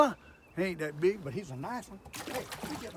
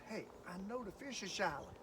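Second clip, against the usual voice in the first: normal talking voice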